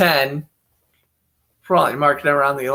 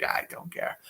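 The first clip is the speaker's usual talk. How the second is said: whispered; 14 dB quieter than usual. Real, not acted. Dismissing a backup quarterback.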